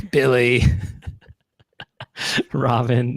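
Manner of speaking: slow, laid-back surfer drawl; Whoopi Goldberg impression. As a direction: draw it out like Whoopi. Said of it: "She sounds like this".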